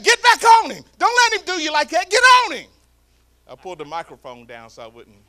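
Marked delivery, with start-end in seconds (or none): 0.0-2.7 yelling from the sidelines, much higher and louder; screaming. Retold or imitated. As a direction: scream it like a fired-up grandpa. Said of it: "He said".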